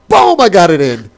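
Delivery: high, loud triumphant burst; triumphant shout. Real, not acted. Triumphant call on getting it in.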